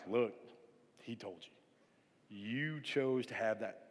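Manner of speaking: slow, low and hushed reproach; imitated drawn-out, quiet told-you-so; deliberate told-you-so. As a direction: spell it out slowly and softly to a sibling. Retold sibling scolding his brother.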